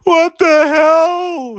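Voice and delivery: high, slow, loud scream. Acted. Jerry snapping back to reality, screaming. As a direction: drag out a shocked scream. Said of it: "he screamed".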